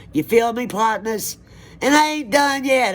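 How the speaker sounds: high, loud pumped-up call to viewers; rally the audience, fired up; hyped call-out. Real, not acted. Hyping up her viewers.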